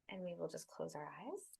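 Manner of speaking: unhurried, soothing breathwork guidance; guided meditation. Acted. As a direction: guide them gently into the body and breath.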